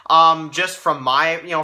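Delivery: ordinary talk, nothing marked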